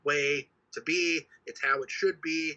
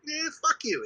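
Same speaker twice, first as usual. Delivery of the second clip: mock retort; 11 semitones higher than usual. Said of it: "And it's like".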